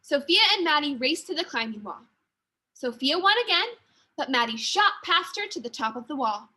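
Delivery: ordinary delivery, nothing marked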